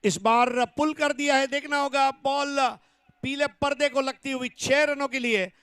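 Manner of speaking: loud, high six call; excited cricket commentary. Real, not acted. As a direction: yell it over the crowd.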